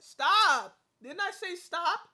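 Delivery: sharp loud yell; shouting stop. Real, not acted. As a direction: bark the order to stop. Scolding someone to stop.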